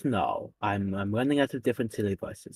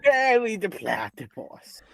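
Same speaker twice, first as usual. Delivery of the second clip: sarcastic cartoon villain greeting his nemesis; Doofenshmirtz impression; 8 semitones higher, 5 dB louder than usual. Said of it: "sound like Doofenshmirtz".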